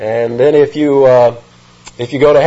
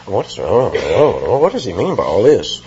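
lightly voiced puzzled murmurs from a crowd; a hint of confused crowd chatter; buzzing audience; 5 semitones lower than usual. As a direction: imitate a puzzled audience buzzing, a bit low. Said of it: "some buzz among the audience".

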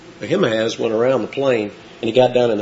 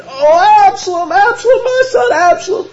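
much higher, crying wail of a broken father; keening cry, high and louder; grieving wail; 5 dB louder than usual. Acted. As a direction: wail his son's name through tears. Soldiers hear David wailing.

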